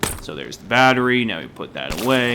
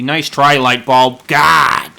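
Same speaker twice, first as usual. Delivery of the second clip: loud, higher, sarcastic scolding; exasperated outburst; 4 semitones higher, 9 dB louder, slower than usual. Real, not acted. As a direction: burst out in loud annoyance at an object. Mock outburst at misbehaving light bulb.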